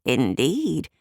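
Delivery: usual speaking voice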